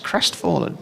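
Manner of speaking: normal talking voice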